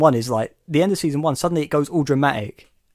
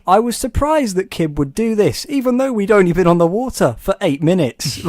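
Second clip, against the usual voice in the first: higher, louder mock narrator voice; 5 semitones higher, 5 dB louder than usual. Quoting the show's narrator to a friend, mock narrator voice. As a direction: read it like a mock documentary narrator. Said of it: "you hear Steven's narration, he goes".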